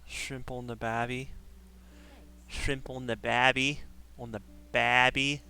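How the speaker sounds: hammed-up, badly faked Australian; mock Aussie, broadly overdone; bad Australian accent. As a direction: lay on a terrible Australian accent. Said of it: "do a really bad Australian accent".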